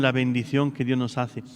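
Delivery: normal talking voice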